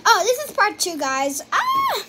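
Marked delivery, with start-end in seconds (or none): 1.5-2.0 startled yelp. Real, not acted. Startled by mistake on camera.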